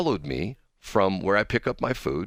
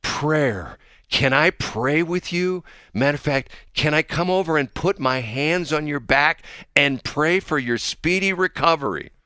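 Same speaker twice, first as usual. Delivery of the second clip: higher, slow, earnest pleading in imitation; lady impression; 7 semitones higher, slower than usual. Host voicing the lady asking to pray over him, earnest high voice. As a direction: mimic a devout stranger offering prayer, drawn out. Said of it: "She says".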